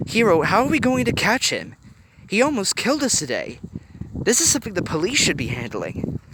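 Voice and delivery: alarmed objection. A narrator's reading. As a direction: talk him out of it. Riley objecting.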